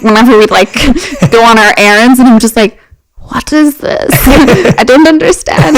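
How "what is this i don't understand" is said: high-pitched, baffled kid, hammed-up; playfully exaggerated confusion; mock bewilderment. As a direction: act out a confused child breaking into laughs. Acting her bewildered younger self.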